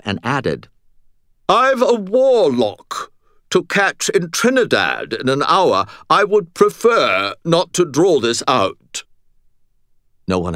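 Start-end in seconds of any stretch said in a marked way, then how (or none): 1.5-9.0 high, loud, slow British nobleman; obnoxious British accent. A narrator's reading. Butters with a warlock to catch, haughty British accent. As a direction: call out with drawn-out British hauteur. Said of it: "absolutely obnoxious British accent".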